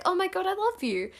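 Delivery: high, quick retold gush; fangirl gushing. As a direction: blurt it out like a starstruck fan. Reenacts gushing to musician.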